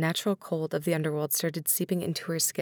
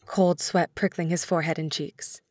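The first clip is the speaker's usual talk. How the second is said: usual speaking voice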